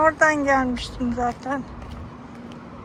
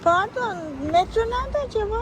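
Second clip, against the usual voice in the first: polite asking; 8 semitones higher, faster than usual. Real, not acted. Asking a stranger for directions.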